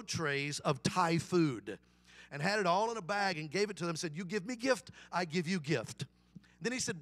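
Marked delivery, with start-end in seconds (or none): 4.2-6.1 imitated Vietnamese-accented English. Restaurant owner handing over Thai food, Vietnamese accent. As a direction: put on a simple Vietnamese accent. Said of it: "try to do a Vietnamese accent".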